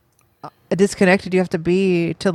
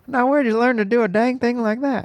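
Texan scolding, slightly higher; Hank Hill impression; 3 semitones higher than usual. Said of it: "the word you gotta say to get into that impression".

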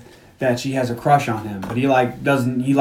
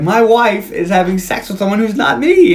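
put-on drunk complaint; 6 semitones higher than usual. He's talking to this girl.

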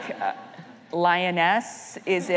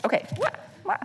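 high mock wah wah; mock your own gloom; sad trombone; 12 semitones higher, faster than usual. Real, not acted. Host mocking her own gloomy point with a high wah wah. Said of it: "self-mocking sad trombone".

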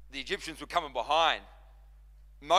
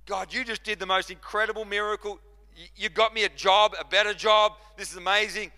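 loud, high, thrilled outburst; excited praise; 6 semitones higher, 9 dB louder than usual. Acted. Believer thanking God for a new job, excited. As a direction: gush loudly at God over a miracle. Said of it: "All of us have our freak out moments".

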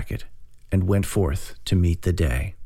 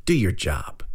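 curt, understated snap; a short, mildly irritated order; snapped. A narrator's reading. Chad orders the staring driver, snapping. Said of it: "he snapped".

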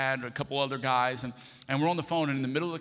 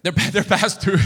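loud, higher stammered echo; mock alarm; 4 semitones higher, 10 dB louder than usual. Husband echoes wife's news of overdue bills, in loud mock alarm. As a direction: blurt it back, stumbling and much louder.

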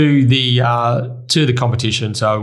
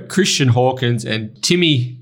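ordinary talk

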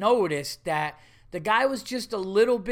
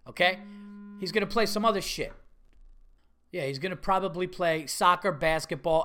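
normal talking voice

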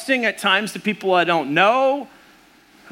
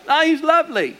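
much higher, hammed-up Australian accent; bad Aussie voice; 9 semitones higher than usual. Preacher showing how Australians praise good people, mock Aussie accent. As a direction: attempt an Aussie lilt, pitched way up. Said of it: "I can't do an Aussie voice".

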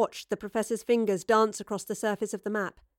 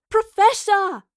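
high, loud urgent shout; crying out; 11 semitones higher, 10 dB louder, slower than usual. A narrator's reading. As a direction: yell to break into the tirade. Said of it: "Astrid cried".

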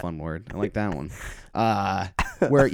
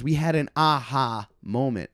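slow, spelled-out "aha"; slow repeat; 3 semitones higher, slower than usual. Retold or imitated. Lady repeats herself to confused comic, slow and distinct "aha". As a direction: repeat it slowly, separating "aha". Repeats it after not being understood.

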